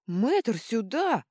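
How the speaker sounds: loud, high commanding yell; roaring. A narrator's reading. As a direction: bellow for the healer to come. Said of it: "взревела Клозильда". (громкий рёв)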